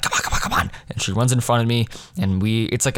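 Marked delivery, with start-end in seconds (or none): 0.0-0.7 hurried backstage whisper, no voice; urgent whisper. Retold or imitated. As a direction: hiss it fast so the audience can't hear. Urging castmate to hurry backstage.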